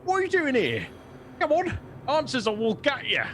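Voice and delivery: high-pitched goblin making threats; nervous goblin threat. Acted. Shivering goblin threatening intruders at swordpoint, high goblin voice. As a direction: threaten them in a high goblin voice, trying to sound tough. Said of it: "she appears to be shivering".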